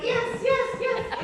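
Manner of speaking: very high squealed yes yes yes, hammed up; mock relieved cheer. Friends mock-cheering the end of his violin playing, high squeals. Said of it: "we were just like".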